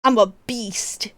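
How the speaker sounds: whispered, slow mock beast; playful beast voice. Streamer playing the beast for chat, mock beast whisper. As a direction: lightly growl it out like a beast. Jokes about being a beast, attempts growl.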